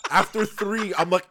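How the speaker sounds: ordinary delivery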